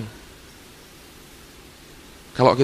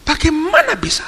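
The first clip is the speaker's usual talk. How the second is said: outraged cry. Retold or imitated. Visiting preacher demanding how this could happen, outraged cry. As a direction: fling the question out in disbelief. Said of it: "Ngomongnya kasar".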